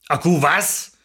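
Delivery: low, unimpressed man's voice; unenthusiastic husband. A narrator's reading. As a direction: ask it grudgingly, pitched low. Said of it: "Karl-Heinz klang nicht sehr begeistert".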